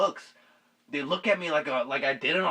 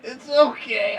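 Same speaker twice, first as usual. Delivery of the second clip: lightly voiced higher kid, mildly soothing; reassuring kid; 10 semitones higher, slower than usual. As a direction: imitate the kid saying it's fine. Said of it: "he was like".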